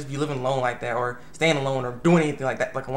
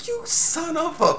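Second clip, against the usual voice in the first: much higher, slow, strangled outrage; indignant; 13 semitones higher, slower than usual. Retold or imitated. Himiko outraged at being volunteered, high and slow. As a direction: choke on the insult. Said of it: "freaking Himiko's like".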